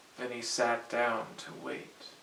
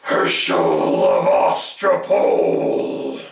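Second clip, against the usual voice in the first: much lower, loud and slow monster call; fearsome goblin voice; 10 semitones lower, 14 dB louder, slower than usual. Acted. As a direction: boom his name like a looming monster. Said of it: "A fearsome voice spoke".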